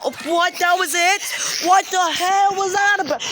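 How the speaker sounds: high-pitched startled outburst; shocked disbelief. Real, not acted. Vlogger after sudden blast, shocked disbelief.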